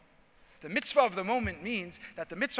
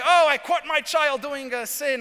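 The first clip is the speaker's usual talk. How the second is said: high, loud, slow triumphant gotcha; gotcha parent; 7 semitones higher, 10 dB louder than usual. Retold or imitated. Parent to child caught sinning, loud high gloating. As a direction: savor catching the child out.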